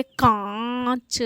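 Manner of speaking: कांच with very long nasal आ, separate च; nasalised vowel demo. Shown as a demonstration. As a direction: stretch कांच through mouth and nose. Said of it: "तो ये उच्चारित होगा".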